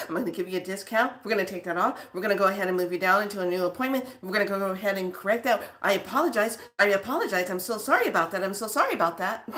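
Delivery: rapid-fire customer-service backpedalling; hurried apologetic staff. Acted. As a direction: rattle off concessions and apologies without a breath. Acts out cowed staff backing down.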